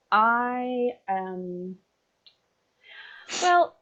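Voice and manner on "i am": ordinary talk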